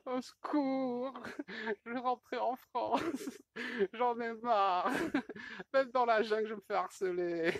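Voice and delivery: high, slow whiny monkey voice, laughing through it; voicing the monkey. Man voicing his monkey in the jungle, high whiny complaint. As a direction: play the fed-up little monkey begging for help.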